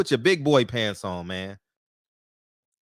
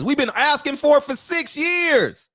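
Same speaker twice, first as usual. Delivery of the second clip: much higher, louder frustrated outburst; heated rant; 8 semitones higher, 3 dB louder than usual. Real, not acted. Fan venting at promoters over the fight, higher and louder frustration. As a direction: vent like a fan fed up after years of waiting.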